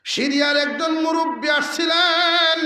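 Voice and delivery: loud, high, ringing announcement; preacher's proclamation. Real, not acted. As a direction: proclaim it high and stretch the last word. Preacher proclaims the visitor's arrival.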